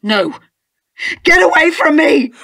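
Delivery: terrified scream. Acted. Wife screaming at the husband to keep away, panicked. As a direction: scream him away from you.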